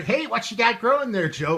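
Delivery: high, loud teasing voice; teasing co-host. Pretend. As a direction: poke fun at his beard in a high voice.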